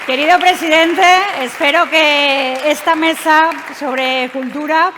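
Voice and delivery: host's rousing sign-off; formal closing speech. Acted. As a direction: wrap up the panel with ceremony.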